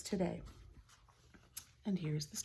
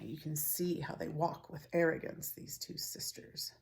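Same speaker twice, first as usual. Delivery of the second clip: soft unvoiced whisper; whispered aside; 3 semitones lower, 7 dB quieter than usual. Real, not acted. Reader whispering about the arrogant sisters. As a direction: whisper it to the listeners.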